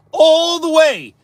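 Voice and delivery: "all the way" drawn out slow, high and loud; exasperated emphasis. Acted. Streamer retelling the driver going to his trailer, exasperated. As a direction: stretch "all the way" in disbelief.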